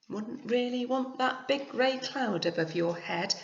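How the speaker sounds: usual speaking voice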